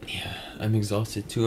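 tired whisper. Real, not acted.

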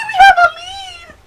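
high, loud triumphant cry; thrilled yell; excited shout. Real, not acted. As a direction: shout the news like a detective. Celebrating a clue.

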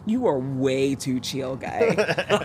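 low, slow, mock-exasperated to the instructor; deadpan complaint. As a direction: drag it out like you can't believe him. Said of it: "And I was like".

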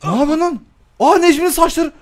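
very high, loud shriek; overwhelmed by chaos; shrieking surprise. Real, not acted. Gamer shrieks at Necmi's hair, stunned. Reacting wildly.

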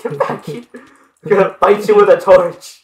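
mock-menacing, half laughing; playful mock threat.

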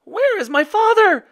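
very high, loud frightened cry; screamed question. A narrator's reading. Boy facing a dragon, screaming for his father. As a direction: shriek it in alarm. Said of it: "screamed Radius".

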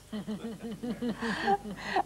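soft laugh after a joke; light run of laughter; amused laugh. Real, not acted. Laughs after own poem.

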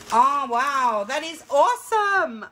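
high-pitched squeal of real amazement; excited scream. Real, not acted. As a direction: screech it out, thrilled. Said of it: "I'm sorry if I'm screaming".

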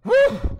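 very high loud whew; exhausted whew. A narrator's reading. Rafter dropping the heavy raft, loud relieved whew. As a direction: blow out relief. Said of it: "Johnny said".

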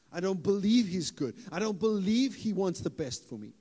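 ordinary delivery

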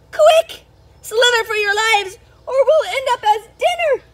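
high, loud frantic urging; panicked shout. Acted. Snails fleeing the big bird, frantic and loud. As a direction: hurry everyone away in terror. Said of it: "cried the snails in panic".

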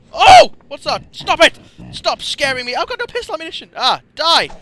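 very high, loud startled shouting; frantic, high-pitched alarm; panicked. Real, not acted. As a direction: yelp and plead as you're attacked. Startled, fighting off attacker.

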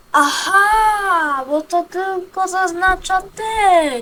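głośno, podekscytowane olśnienie; excited. Real, not acted. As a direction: wykrzycz z ulgą, wolniej na końcu. Sudden loud realization in game. (głośne, podekscytowane „aha”)

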